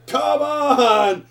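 high, loud, drawn-out yell; acted exasperated shout. Gamer imitating frustration while painting tiny boots, exasperated shout. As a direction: shout it like a fed-up player. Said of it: "You're like".

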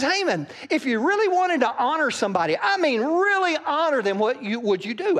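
high, loud, fast asking; eager king. Acted. King eagerly asking Haman, high and loud. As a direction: lean in, eager to know.

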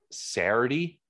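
"Saturday" squashed into "Serr-dee", rising; grandma's local pronunciation. Retold or imitated. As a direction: imitate grandma's Delco "Saturday". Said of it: "my grandma says".

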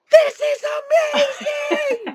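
thrilled laughing cry; excited shout. Retold or imitated. Store staff amazed by Minnie voice, laughing shout. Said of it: "they were just like".

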